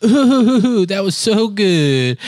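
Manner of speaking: high, loud, drawn-out mock sob; hammed-up tearful relief; imitated crying. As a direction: wail it slowly like an overwhelmed patient. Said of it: "now in a crying way".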